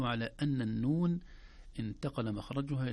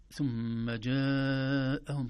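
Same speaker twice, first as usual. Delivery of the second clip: ثم جاءهم chanted very slowly, long madd on جاءهم; slow tajweed chant; Quranic recitation; 3 semitones higher, 5 dB louder, much slower than usual. Shown as a demonstration. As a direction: stretch the vowel in جاءهم like a reciter. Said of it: "كلمة جاءهم فيها تنبيهان".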